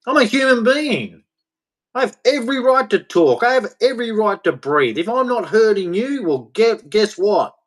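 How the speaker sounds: high-pitched, insistent indignation; indignant protest. Real, not acted. Speaker asserting their rights to those who would control them, indignant. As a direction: pitch up high and stand your ground.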